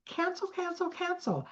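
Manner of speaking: high, soft singsong cancel; cancel command. Shown as a demonstration. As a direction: trill it high and light to brush the thought off. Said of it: "you say something like".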